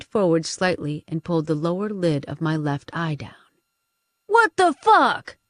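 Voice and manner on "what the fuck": startled disbelief. A narrator's reading. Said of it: "muscular woman behind Tremblay murmured".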